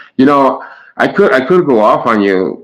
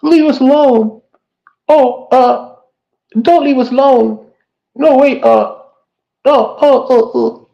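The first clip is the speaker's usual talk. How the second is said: much higher, whiny mocking voice; mock them in a high, silly whine; much higher, sarcastic whine mocking contradictory people; slower than usual. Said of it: "It's like".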